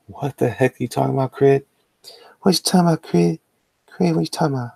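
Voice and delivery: high-pitched pop star voice; [name]. Pretend. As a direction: squeak it up high and fast. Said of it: "[name]".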